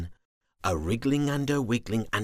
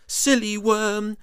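high, loud scolding worm; 12 semitones higher, 8 dB louder than usual. Acted. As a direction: scold him like a big sister. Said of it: "she scolded".

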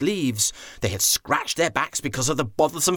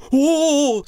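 excited oh; 13 semitones higher, 7 dB louder than usual. Acted. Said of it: "said the chief elephant".

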